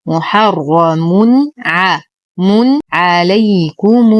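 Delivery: the nunation said clearly before the ع; slow recitation. Shown as a demonstration. Teacher demonstrates clear nunation before ع, slow recitation. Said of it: "نظهر التنوين ونظهر العين".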